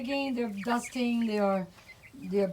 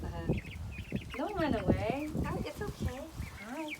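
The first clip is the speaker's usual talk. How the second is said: softer, gentle hellos to shy chickens; soft coaxing to hens; 4 semitones higher, 7 dB quieter than usual. Real, not acted. Owner softly greeting her hens so they won't run. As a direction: coax the hens quietly, don't spook them.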